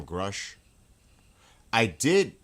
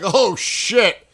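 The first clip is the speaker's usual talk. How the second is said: alarmed outburst; 12 semitones higher, 13 dB louder than usual. Retold or imitated. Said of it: "I was like".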